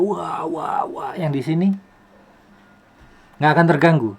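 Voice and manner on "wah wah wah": higher-pitched wah-wah of pretend yelling; half-hearted shout demo; demonstrated mock yelling. Shown as a demonstration. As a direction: play at yelling without real volume. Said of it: "kalau saya teriak-teriak gitu ya".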